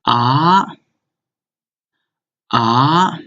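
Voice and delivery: ah rising upward; second tone ah. Shown as a demonstration. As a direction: slide ah up like a question. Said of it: "Second one is".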